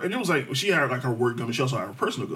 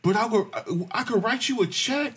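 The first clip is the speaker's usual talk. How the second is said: a mock-sweet higher plea; pleading girl; 10 semitones higher than usual. Him mimicking the girl asking to borrow money, mock pleading.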